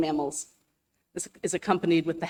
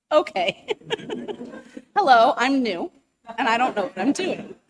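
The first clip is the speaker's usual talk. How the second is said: laughing; 7 semitones higher, 4 dB louder than usual. Real, not acted. Flustered newcomer at wrong podium.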